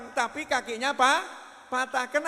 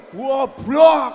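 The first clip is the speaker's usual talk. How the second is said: loud, furious shout of goblok; mock parent yelling goblok; angry shout; 3 semitones higher, 16 dB louder than usual. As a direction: yell the insult at the child. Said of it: "Sering muncul".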